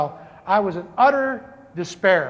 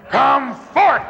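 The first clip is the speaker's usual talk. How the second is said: commanding call; 5 semitones higher, 6 dB louder than usual. Retold or imitated. Repeats the command, stronger.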